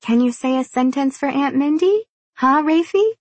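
put-on high sugary baby talk; saccharine. A narrator's reading. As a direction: coo sweetly at a toddler. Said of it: "a voice as sweet as sugar".

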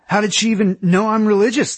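high-pitched, aggrieved question; indignant demand. A narrator's reading. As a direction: demand to know, feeling betrayed. Aaron demands explanation in quarrel.

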